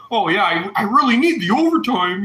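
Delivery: put-on eager voice, climbing in pitch; mocking eagerness. Mocks what people assume about overtime.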